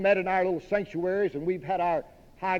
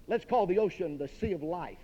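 normal talking voice

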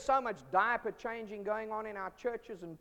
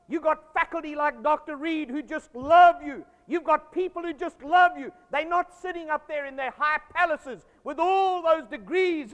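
loud, rising preacher's exhortation, higher in pitch; fiery preaching; 7 semitones higher, 11 dB louder than usual. Acted. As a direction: pour it out from the pulpit at full force. Preaching at full force.

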